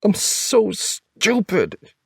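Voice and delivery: very high, slow tearful wail; drawn-out, high-pitched crying voice; starting to cry. A narrator's reading. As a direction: wail it out as tears take over. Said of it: "now she's starting to cry".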